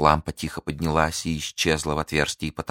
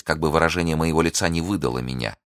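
ordinary delivery, nothing marked

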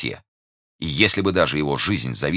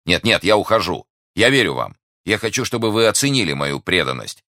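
higher, eager reassurance; earnest protest; 6 semitones higher, 3 dB louder than usual. Acted. Young man promising to leave, earnest protest. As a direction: yield urgently, pitch lifted. Hastily yielding, protests devotion.

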